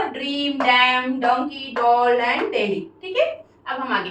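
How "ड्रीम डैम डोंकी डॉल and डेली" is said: slow example words. Shown as a demonstration. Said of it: "डी से ड".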